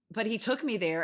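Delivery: ordinary delivery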